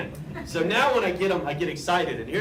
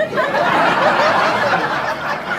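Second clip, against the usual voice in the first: a big burst of real laughter; loud laugh; 8 dB louder than usual. Real, not acted. Cartoonist bursting out laughing at his own pun, loud laugh. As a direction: crack up loudly at the pun.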